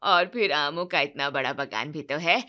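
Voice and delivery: low, loud wedding guest talking up the groom's side; a relative bragging about the bride's father; boastful relative. Acted.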